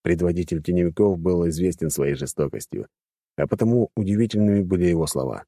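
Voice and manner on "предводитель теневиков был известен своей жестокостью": usual speaking voice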